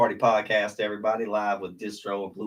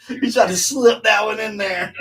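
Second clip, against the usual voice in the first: loud, high, laughing yell; real laughing glee; 12 semitones higher, 10 dB louder than usual. Real, not acted. Co-host teasing him about being set up, gleeful laughing yell. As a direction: crow it through laughter, pitched way up.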